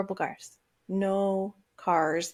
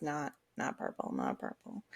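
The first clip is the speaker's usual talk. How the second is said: very soft, low muttered dismissal; muttering to herself; 6 semitones lower, 12 dB quieter than usual. Retold or imitated. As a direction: drop right down into a low mumble. Dismissing passing cars to herself.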